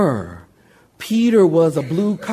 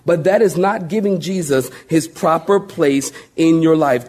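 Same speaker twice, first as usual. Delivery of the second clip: usual speaking voice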